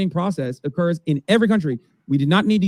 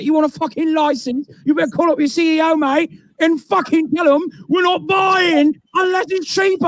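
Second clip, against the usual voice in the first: put-on shouting London accent; 11 semitones higher than usual. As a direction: belt it out in a brash London accent. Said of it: "they're speaking in English in the U K".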